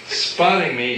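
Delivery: usual speaking voice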